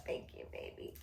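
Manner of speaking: soft, breathy whisper of gratitude; tender whisper to a loved one; whispered thanks. Real, not acted. Wife whispers thanks to her husband, tender and hushed.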